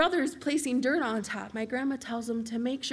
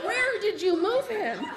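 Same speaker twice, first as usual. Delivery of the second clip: slower, laughing, still high; slowed disbelief; 8 semitones higher, 3 dB louder, slower than usual. Retold or imitated. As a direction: draw the question out, stunned. Shocked reply to grandma's news.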